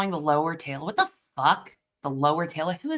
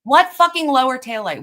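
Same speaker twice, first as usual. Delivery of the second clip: louder and higher, baffled; incredulous outburst; 5 semitones higher, 6 dB louder than usual. Real, not acted. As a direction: demand it in disbelief.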